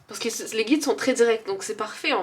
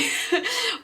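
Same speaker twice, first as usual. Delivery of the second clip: high, bright burst of laughter; amused laugh; 13 semitones higher than usual. Real, not acted. Laughs about building things. (petit rire aigu et amusé)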